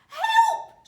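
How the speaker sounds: very high, loud scream for help. A narrator's reading. Wife screaming while shooting up like a rocket. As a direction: shriek it as she shoots up. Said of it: "she screamed".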